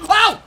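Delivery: loud, high "oh"; sudden shouted gasp of surprise; startled yelp. Real, not acted. Player startled by a wildebeest's jump, loud yelp. Reacts to animal's leap.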